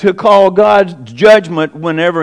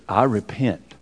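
humble repentance; 7 semitones lower, 8 dB quieter, slower than usual. Acted. Job humbled before God.

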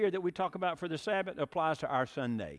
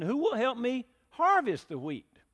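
higher, louder call for helpers; little red hen asking; 8 semitones higher, 5 dB louder than usual. Acted. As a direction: hen asks the barnyard to harvest, higher louder plea. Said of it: "So she said".

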